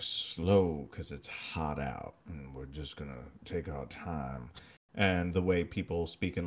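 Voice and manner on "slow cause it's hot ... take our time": low, soft, lazy Southern drawl; drawn-out, low and quiet; slow Southern pace. Shown as a demonstration. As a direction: let it sag like it's too hot to hurry. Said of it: "the pace at which people speak".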